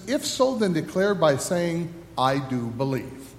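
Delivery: solemn liturgy read by the pastor; liturgical leading. Acted. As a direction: lead the congregation through confession, measured and solemn.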